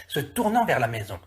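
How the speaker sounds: usual speaking voice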